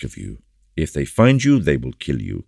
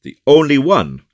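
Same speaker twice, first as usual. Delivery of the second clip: eager cry; 11 semitones higher, 7 dB louder than usual. Acted. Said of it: "cried Aramis eagerly".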